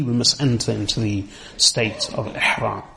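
normal talking voice

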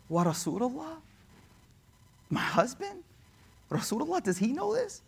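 small, hushed, high questioning; broken daughter. Retold or imitated. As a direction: ask softly, dreading the answer. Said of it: "She's broken".

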